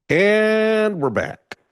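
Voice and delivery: high, loud, drawn-out welcome back; cheerful announcement. Real, not acted. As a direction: greet the viewers back, stretching it out. Stream reconnects, cheerful return.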